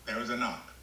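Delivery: ordinary talk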